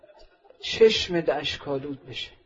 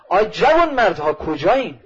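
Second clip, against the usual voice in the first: loud, higher call to the men; shouting out; 3 semitones higher, 10 dB louder than usual. Acted. Habib calling the companions out of their tents, shouting. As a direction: bellow it across the camp. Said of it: "فریاد زد".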